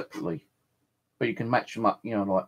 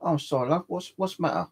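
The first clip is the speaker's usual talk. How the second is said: higher-pitched cashier, questioning; imitating the cashier; 7 semitones higher than usual. Cashier asking a customer about a mistake, imitated in a higher voice. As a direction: raise your pitch to play the puzzled cashier. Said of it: "She goes".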